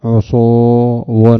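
slow, stretched teaching demo; drawn out. Shown as a demonstration. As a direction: draw the word out slowly for the class. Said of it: "فكان قياس جمع عصا".

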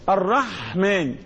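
"الرحمن" slowly, with a long breathy pharyngeal ح; bahha in the ha. Shown as a demonstration. Teacher testing students on the sound, slow bahha. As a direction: draw out the friction of ح in "الرحمن". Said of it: "بحة".

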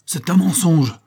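high, loud outburst of denial; indignant cry. A narrator's reading. Trainer hears a whispered accusation, cries out indignantly. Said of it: "s'écria-t-il".

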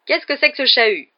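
loud, high indignant question; exclaimed. A narrator's reading. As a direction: cry out at the commotion. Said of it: "s'écria la voix familière de Percy".

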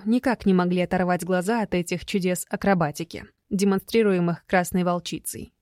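plain reading voice

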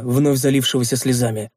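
ordinary delivery, nothing marked

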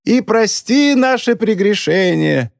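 high, slow, squeaky mock prayer. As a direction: pipe it up in a squeaky voice. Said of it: "подхватила писклявым голосом".

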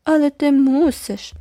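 high, slow mocking echo; mocking repeat. A narrator's reading. Sister mocking the girl, high and slow. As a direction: drag it out high to tease. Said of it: "повторила Джеральдіна".

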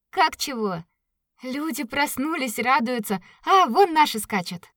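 rising to a glad shout; excited. Acted. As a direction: point and cry out happily. Katya excitedly points out riders.

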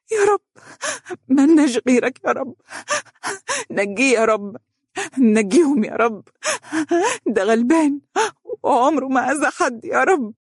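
high, gasping, tearful prayer; wailing sobs. A narrator's reading. Wife prostrate in prayer for the prisoner, wailing. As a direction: sob out a desperate plea to God. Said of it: "وهي تقول بنحيب".

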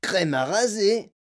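slow, high incredulous cry; Mike crying out. A narrator's reading. Boy astonished at a sign on the door, crying out. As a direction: stretch it out in disbelief. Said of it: "cria Mike T V".

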